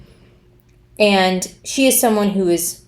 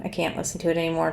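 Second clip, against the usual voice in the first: usual speaking voice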